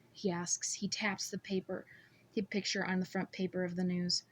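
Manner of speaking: ordinary delivery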